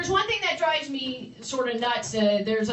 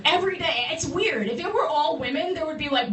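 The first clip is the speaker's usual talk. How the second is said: ordinary delivery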